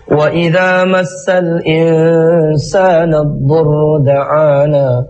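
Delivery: slow, melodic Quranic chanting; Quran recitation. Acted. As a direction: chant the verses slowly and melodically.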